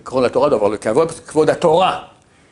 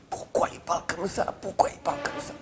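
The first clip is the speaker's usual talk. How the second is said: chuchoté; 4 semitones higher, 10 dB quieter than usual. Retold or imitated. Le Rav gêné par l'annonce.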